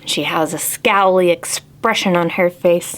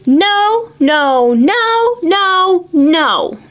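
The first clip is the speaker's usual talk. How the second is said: stubborn refusal; 9 semitones higher, 3 dB louder, much slower than usual. Acted.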